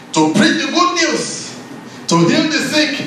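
high, emphatic preacher's proclamation; rousing, high-pitched sermon cry; proclaiming scripture. Acted. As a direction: declare each phrase like an announcement of good news.

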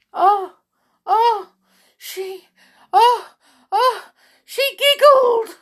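high, loud gasped exclamations; distraught. A narrator's reading. King reacts to the giggle, distraught. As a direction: splutter in horror, building to a wail. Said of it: "he burst into tears again".

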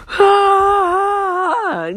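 high, loud startled scream; a loud mock scream at the jump scare; retold scream. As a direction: let out a sudden shriek of fright.

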